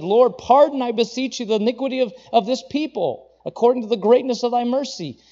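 impassioned pleading prayer; fervent intercession. Acted. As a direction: plead for pardon with full force. Moses interceding for the people.

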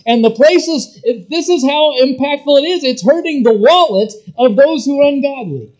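high, loud, worked-up merchant outcry; agitated silversmiths. Retold or imitated. Silversmiths complaining that nobody buys their shrines, high agitated outcry. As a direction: rail like a merchant losing his trade.

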